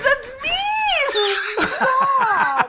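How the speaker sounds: high, slow words through laughter; can barely talk for laughing. Real, not acted. Laughing at the dog.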